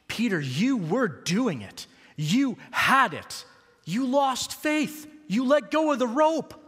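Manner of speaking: Jesus gently urging Peter, then challenging him; delight and encouragement. Acted. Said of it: "a mixture of delight, encouragement, and challenge".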